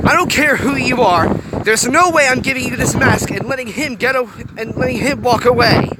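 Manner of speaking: high, loud angry refusal; defiant shout. A narrator's reading. Hiro refusing the Gorg the mask, angry defiance. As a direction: refuse him with fierce defiance.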